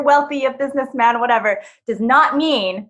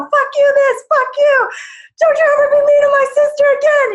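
much higher, louder angry yelling; cursing rage; 5 dB louder than usual. Retold or imitated. Brother warning the bully off, high furious shout. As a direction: spit out the curses in a rage. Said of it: "expletives, every other word".

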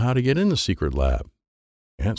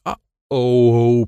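alarmed uh-oh; 3 semitones higher, 6 dB louder than usual. A narrator's reading. Boy seeing the throat-slash sign, alarmed uh-oh. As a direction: gasp at the threat.

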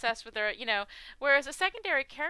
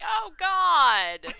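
exasperated; 6 semitones higher, 9 dB louder, much slower than usual. Retold or imitated. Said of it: "And I'm like".

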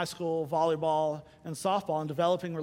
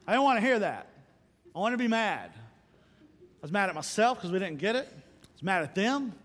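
ordinary delivery

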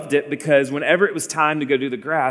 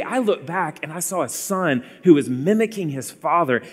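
usual speaking voice

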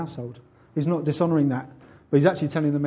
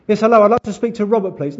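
higher, louder phone greeting; insistent caller; 5 semitones higher, 8 dB louder than usual. Acted. Dad prank-calling a stranger once more, insistent caller. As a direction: play a chirpy repeat caller.

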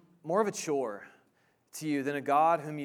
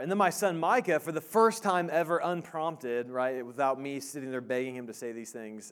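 ordinary talk, nothing marked

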